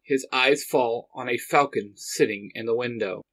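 usual speaking voice

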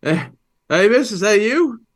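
high, loud bewildered call; waking confused, reaching for your son; dazed father. Acted. Father waking on the porch, confused cry. Player character wakes disoriented.

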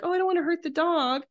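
high, hand-wringing mock reluctance; mock squeamish. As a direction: play the timid softie who won't fight back. Mock squeamish reply to dog.

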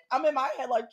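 ordinary talk

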